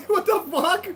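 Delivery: laughing disbelief. Real, not acted.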